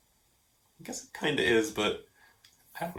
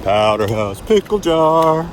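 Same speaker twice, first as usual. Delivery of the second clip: drawn out, higher and louder; slow character voice; 6 semitones higher, 9 dB louder, slower than usual. Acted. Cartoon creature announcing its name, slow and high.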